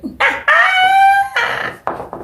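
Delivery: long high-pitched laugh; amused laugh. Real, not acted. Laughing at "that is not fair", high laugh. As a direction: burst out in high giggles. Laughs at her own remark.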